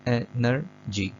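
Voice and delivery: "एनर्जी" slowly in three separate beats; syllable by syllable. Shown as a demonstration. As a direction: break "एनर्जी" into ए, नर, जी with pauses. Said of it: "तीन इसमें bit of sounds आते हैं". ("एनर्जी" को धीरे, तीन हिस्सों में तोड़कर)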